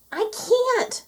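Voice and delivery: very high urgent yell. A narrator's reading. Moo yelling back that she cannot stop, alarmed. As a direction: cry out helplessly. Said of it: "yelled Moo".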